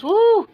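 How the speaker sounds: high, loud "woo"; excited whoop. Retold or imitated. Man spotting the sister's car, high excited whoop. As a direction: let out a sudden high whoop of admiration.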